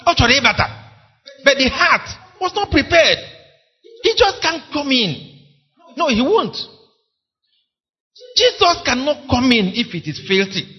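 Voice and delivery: rising sermon fervor; fiery preaching. Acted. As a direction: preach it with urgency. Preacher rising to full force.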